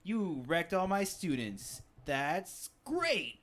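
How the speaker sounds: mock-congratulation building into a challenge; challenging teacher. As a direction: play a teacher squaring up for a fight. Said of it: "talk to her like".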